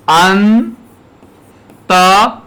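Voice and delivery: "अन" held long, pause, then "त"; syllable by syllable. Shown as a demonstration. Teacher writing out a word for students, syllable by syllable. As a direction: sound out अन and त as separate pieces. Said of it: "जहां रे का उच्चारण होगा".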